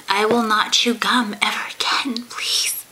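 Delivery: hushed, pleading promise; whispering. Pretend. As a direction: whisper it like a guilty kid. Mock contrite promise after complaints.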